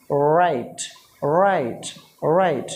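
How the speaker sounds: राइट with a curled English r; राइट in a British accent. Shown as a demonstration. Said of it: "लोग वहाँ के पढ़ते हैं simply राइट".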